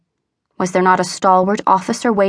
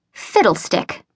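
snarled; slower than usual. A narrator's reading. Mistress snarling at her maid's excitement, scornful. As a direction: spit the word out with contempt. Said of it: "again snarled her mistress".

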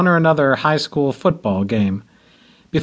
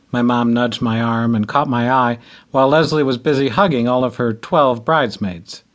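plain reading voice

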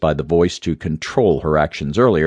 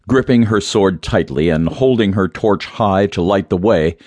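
ordinary delivery

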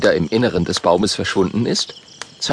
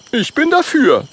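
higher, louder eager voice; Oskar's voice; 4 dB louder than usual. Acted. Oskar votes for the trip, higher eager voice. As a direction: jump in eagerly. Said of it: "sagt Oskar".

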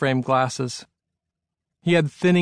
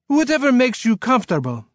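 put-on high, accommodating French-accented host; 10 semitones higher, 3 dB louder than usual. A narrator's reading. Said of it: "in a French-sounding accent".

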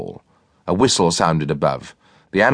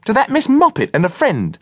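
high cook voice; 4 dB louder than usual. A narrator's reading. Cook's voice.

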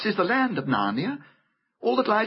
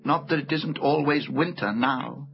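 ordinary delivery

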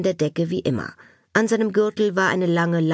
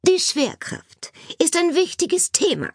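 high-pitched, prim concession; stern teacher; 12 semitones higher than usual. A narrator's reading. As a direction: concede the point strictly, as a teacher. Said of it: "schürzte streng die Lippen".

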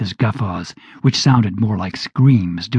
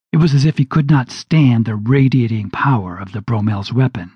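ordinary delivery, nothing marked

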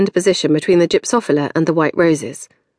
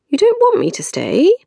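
higher, hurt question; 12 semitones higher than usual. A narrator's reading. Maxine hurt that Janey won't let her stay, higher pitch. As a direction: sound wounded by the refusal. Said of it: "Maxine looked hurt".

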